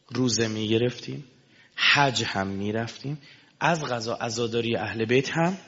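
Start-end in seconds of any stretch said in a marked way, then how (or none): none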